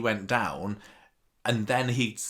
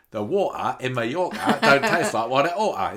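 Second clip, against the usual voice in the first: a hint of a posh plummy accent, slightly louder; 4 semitones higher, 6 dB louder than usual. Retelling a seventies advert, light plummy accent imitation. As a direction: lightly mimic a 1970s advert girl's refined diction. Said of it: "she's speaking all plummy".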